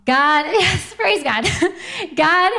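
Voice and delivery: laughing through a joyful "praise God"; giggly thankful outburst. Real, not acted. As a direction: bubble over with real delight. Joyful aside about first date.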